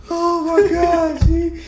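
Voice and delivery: high, slow, laughing exclaim; laughing at friend after the knock; laughing shout. Real, not acted. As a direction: howl it out mid-laugh.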